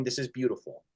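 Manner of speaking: Jesus gently answering the critics, soft and tender; soft tender reply. Retold or imitated. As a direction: say it softly, like a quiet comfort.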